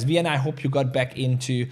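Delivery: ordinary talk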